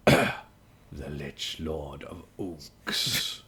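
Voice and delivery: slow, low, throat-cleared lich lord voice; ancient rasping undead king. Acted. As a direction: intone it like a dread undead king. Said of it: "I'll read it in my Dimir voice".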